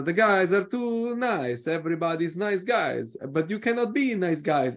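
imitating an Italian coach's English; Italian accent. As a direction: mimic an Italian manager at a press conference. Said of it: "he's an Italian guy".

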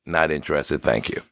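mock-polite brush-off, low and soft; politely decline, quiet and low; super polite. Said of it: "I said it super politely".

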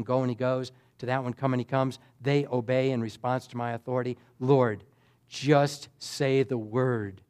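low, soft, deferential voice; hushed, respectful soldier addressing his superior; humble petition. Retold or imitated. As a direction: plead quietly, pitched well down. Centurion humbly petitions Jesus.

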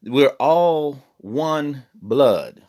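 ordinary talk, nothing marked

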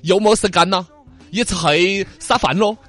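put-on slow Cantonese-accented Mandarin, last line dragged; 广东口音. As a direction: imitate a Guangdong friend. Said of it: "广东人说呢".

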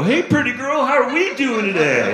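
high, sing-song voice for a dog; baby talk to a drug dog; sweet talking to dog. Retold or imitated. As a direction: coo at the dog like a pet owner. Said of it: "my natural reaction ... was to go".

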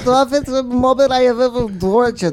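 put-on Russian accent, laughing through it; gruff Russian interrogator with a laugh. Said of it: "Did you just do that in Russian?".

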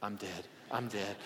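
hushed panic while fleeing; terrified. Retold or imitated. Boy running from his dad through the snow, hushed terrified mutter. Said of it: "And I'm like".

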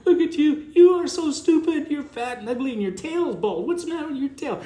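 very high, cooing pet voice; sweet dog-talk tone. Acted. Owner talking to goofy little dog, high sweet tone. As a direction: coo mock insults as if praising a puppy. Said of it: "if I use the right tone".

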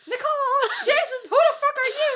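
high, loud, laughing imitation; laughing mock yell. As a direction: mimic her yelling, laughing. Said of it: "yelling at the wall".

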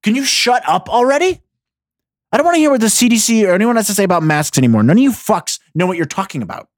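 loud, fast ranting reply; angry retort. Retold or imitated. Ranting back at the health news, angry and fast. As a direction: snap back at the news, fed up. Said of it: "I replied back".

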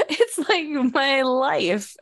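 slow, laughing through the words. Real, not acted. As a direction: laugh it out slowly. Joking about own life.

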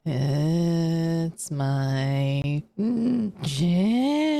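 long, drawn-out wavering moans; eerie, slowly swooping creature voice; swaying shadow creature. Acted. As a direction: stretch each word like a snaking shadow. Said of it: "starts swaying their head back and forth, going".

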